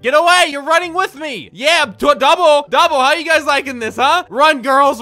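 loud, high, hyped yelling; gleeful shouting. Real, not acted. Gamer chasing fleeing players, gleeful shouting. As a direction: chase them down, shouting.

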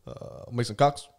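put-on lightly done Obama accent, slow; a hint of Obama's measured pause before the name; Obama impression. Guest asked to redo the player's name as Obama. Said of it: "what about in Obama's voice?".

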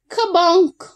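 bumped smack into a tree; bonk. A narrator's reading. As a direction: land the crash like a cartoon bump. Elk bumps into tree.

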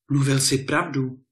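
exclaiming. A narrator's reading. Petřík sees the cloak shine gold, exclaiming. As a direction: cry out that it really works. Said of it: "vykřikl Petřík".

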